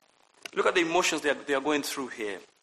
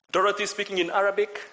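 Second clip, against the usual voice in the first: ordinary delivery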